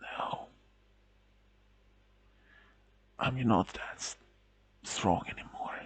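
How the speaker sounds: weak whisper. A narrator's reading. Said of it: "he shook his head and said".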